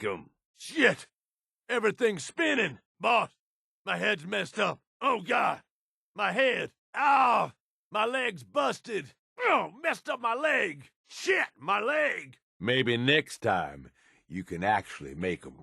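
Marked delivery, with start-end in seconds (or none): 0.6-12.4 pained cries, pitched much higher; hurt and dizzy. Acted. Wounded companion telling boss about his leg, in pain. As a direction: reel from the blow, groaning over the busted leg.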